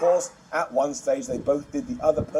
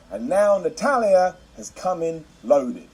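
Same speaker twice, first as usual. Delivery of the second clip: slow quote; slower than usual. Retold or imitated. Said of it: "she then said".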